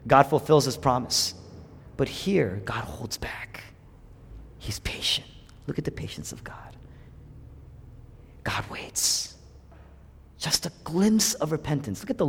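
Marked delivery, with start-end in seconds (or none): 2.0-9.3 whisper. Real, not acted. Preacher whispering to the congregation. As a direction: lean in and whisper it.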